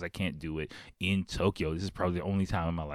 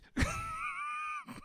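triumphant cackle; 8 dB quieter than usual. Acted.

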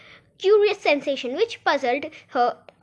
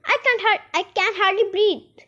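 squeezed Dormouse; 7 semitones higher, 3 dB louder than usual. Acted.

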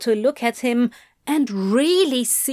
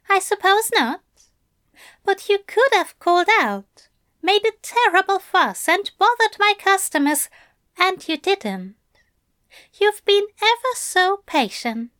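higher, coaxing angel voice, a little louder; pitched-up gentle angel praising his demon; Aziraphale's voice; 10 semitones higher, 3 dB louder than usual. A narrator's reading. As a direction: purr the praise up high like a doting angel.

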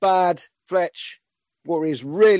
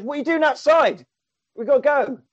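lightly voiced hero, higher pitched; mock Superman voice; 9 semitones higher than usual. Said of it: "goes in and gets changed and goes".

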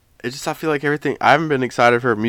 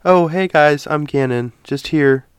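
higher-pitched mock non-country voice; acting his everyday self; 6 semitones higher than usual. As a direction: parody a bland Yankee podcast intro. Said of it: "I have to act like".

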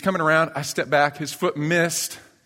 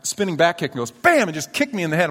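normal talking voice

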